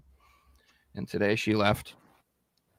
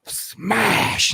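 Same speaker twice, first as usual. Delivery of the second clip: louder, higher taunting threat; mock threat; 5 semitones higher, 6 dB louder, slower than usual. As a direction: snap out the threat then yell smash. Threat before smashing tools.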